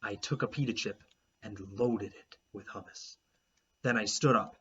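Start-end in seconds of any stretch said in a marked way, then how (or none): none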